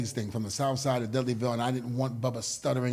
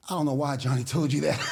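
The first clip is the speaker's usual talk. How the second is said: laughing it off nervously, a bit loud; 3 dB louder than usual. Retold or imitated. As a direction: laugh through the words like you're sweating it out. Nervous answer to a menacing wrestler.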